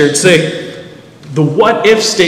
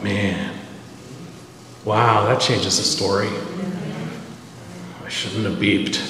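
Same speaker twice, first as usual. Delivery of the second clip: chastened murmur; 7 semitones lower, 11 dB quieter than usual. Retold or imitated. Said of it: "I was like".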